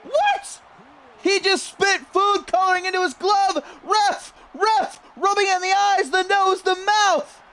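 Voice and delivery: high, indignant yelling; outraged shout. Real, not acted. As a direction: call out the cheat, outraged. Heel cheats with food coloring.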